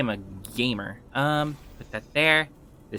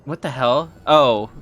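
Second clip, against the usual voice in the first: ordinary talk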